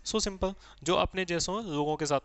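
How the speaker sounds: usual speaking voice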